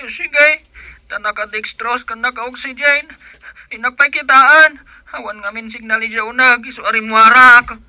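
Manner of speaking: loud and high; shouting over a bad signal; urgent phone talk. Son on the phone at the hospital, shouting over static.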